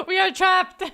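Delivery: high, loud mock wail through laughter; mock trapped viewers. Streamer voicing her chat's complaint, mock wail. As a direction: cry out like a trapped crowd, laughing. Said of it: "Everyone's just like".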